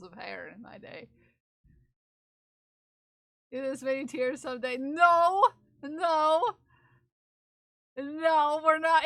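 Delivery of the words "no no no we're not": loud playful no, hammed-up; mock protest. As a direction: shout it down in mock outrage.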